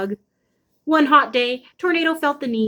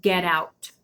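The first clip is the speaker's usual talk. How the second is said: deeper growl; 4 semitones lower, 4 dB quieter than usual. Acted. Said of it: "another deeper sound".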